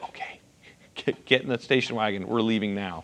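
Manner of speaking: low hush, trying not to wake anyone; hushed urgent getaway whisper; hushed sneaking whisper. Retold or imitated. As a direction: whisper it like slipping out at night. Sneaking off at night.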